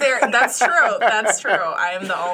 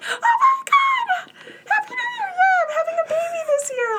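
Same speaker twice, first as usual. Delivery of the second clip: excited; 4 dB louder than usual. Retold or imitated. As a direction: squeal it with joy. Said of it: "I answer it like that. I'm like".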